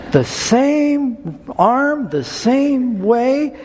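"same" drawn out high and slow both times; slow emphasis. Real, not acted. Pastor marveling at the matching injury, slow and emphatic. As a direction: stretch each "same" to marvel at it. Stressing the girl's identical injury.

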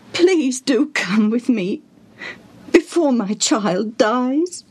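high, slow, whispery plea; acted anguished father's appeal; desperate pleading. A narrator's reading. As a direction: beg him slowly, close to tears. Father begging Jesus to come.

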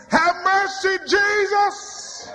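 high, crying out for mercy; shouted plea. Acted. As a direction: call out to Jesus across the road.

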